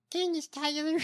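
lightly delivered, high-pitched impression; Candace Taylor imitation. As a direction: pitch it up for a mock accent. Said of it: "Candace's accent".

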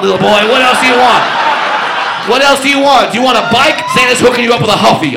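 loud furious challenge; angry shouting. Retold or imitated. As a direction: shout it at him, fed up. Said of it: "He's like".

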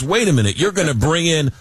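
high-pitched outrage, without real shouting; mock screaming protest. Schools objecting to tax breaks, mock screaming protest. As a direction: imitate indignant schools, pitched well up. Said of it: "screaming at the top of their lungs".

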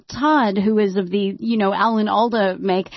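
ordinary talk, nothing marked

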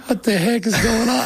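high, panicked outburst; retelling a moment of panic, voice shooting up; freaking out. Retold or imitated. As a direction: blurt it in a rush of alarm. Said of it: "like, freaking out".

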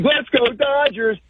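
high, slow mock cheer; mock team-supporter call; imitating cheering fan. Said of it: "He's like".

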